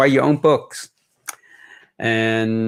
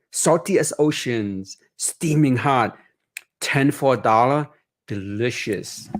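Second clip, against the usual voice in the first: story narration; 2 semitones higher than usual. A narrator's reading. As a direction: read it gently like a bedtime story. Author reads picture book aloud.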